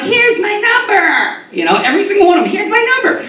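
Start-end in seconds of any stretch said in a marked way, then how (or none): 0.0-1.5 high, slow flirty voice; flirty LA woman. Retold or imitated. Comic imitates LA women offering their number, high slow flirt. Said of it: "L A women are like". 2.5-3.2 flirty LA woman. Retold or imitated. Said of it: "L A women are like".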